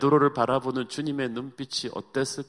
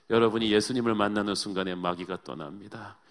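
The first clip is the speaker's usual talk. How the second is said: ordinary delivery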